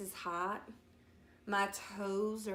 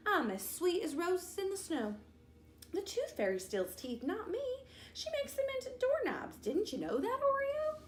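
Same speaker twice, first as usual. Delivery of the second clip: put-on high, sing-song bratty girl voice; smug little sister mocking her brother; teasing little sister; 11 semitones higher than usual. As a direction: tease him from upside down.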